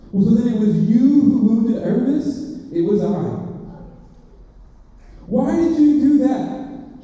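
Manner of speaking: put-on high, loud, urgent boy; indignant young boy; boy's voice. A narrator's reading. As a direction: demand answers like a hurt child. Boy Shasta questioning.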